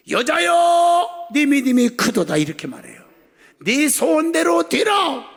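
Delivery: loud, high proclamation with drawn-out calls; full-force preaching. Acted. Preacher quoting Jesus to the woman, loud proclamation. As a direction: proclaim it as Jesus' solemn declaration.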